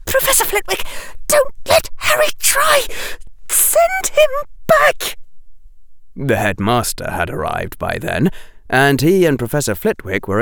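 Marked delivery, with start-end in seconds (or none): 0.1-5.2 very high, desperate cry; panicked plea. A narrator's reading. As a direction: beg frantically in a high voice. Girl begs teacher in panic.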